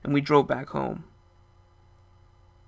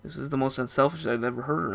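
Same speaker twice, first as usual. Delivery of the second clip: plain reading voice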